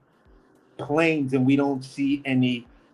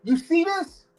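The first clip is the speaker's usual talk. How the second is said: high-pitched call-out to bystanders; imitated "hey!" holler, pitched way up; retold yell; 3 dB louder than usual. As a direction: reenact calling up to people on a terrace. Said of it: "I yell out like".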